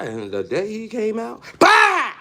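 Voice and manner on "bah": mock shout.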